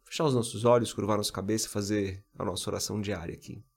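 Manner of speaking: ordinary delivery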